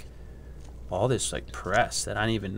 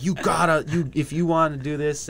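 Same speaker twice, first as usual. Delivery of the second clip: higher, louder mock urging; imitated insistence; 5 semitones higher, 4 dB louder than usual. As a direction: mimic colleagues insisting, amused. Retold colleagues insisting.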